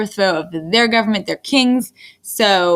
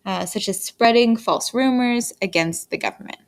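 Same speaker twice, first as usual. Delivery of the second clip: ordinary talk, nothing marked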